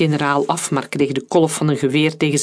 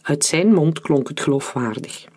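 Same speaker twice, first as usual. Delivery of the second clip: ordinary delivery, nothing marked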